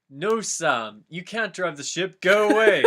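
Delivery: a scolding Gandalf, rising as he goes; Gandalf yelling. Acted. Gandalf shooing Sam off the ship, rising yell.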